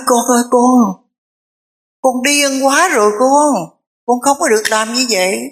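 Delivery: a father begging his son to stop; pleading father. A narrator's reading.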